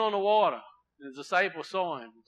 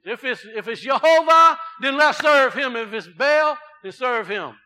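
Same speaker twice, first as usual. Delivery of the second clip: shouted, high and loud challenge; Elijah's challenge; 7 semitones higher, 12 dB louder than usual. Retold or imitated. Elijah challenging the people on the mountain, shouting. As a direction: shout the ultimatum to the wavering people. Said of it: "What did Elijah say".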